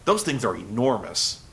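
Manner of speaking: ordinary talk, nothing marked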